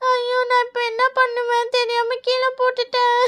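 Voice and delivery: put-on wailing child over spilt food; acted crying. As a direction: cry it out, drawing out the line ends. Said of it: "அழாத, எதுக்கு அழுவுற?". (அழுதுகொண்டே புலம்புவது)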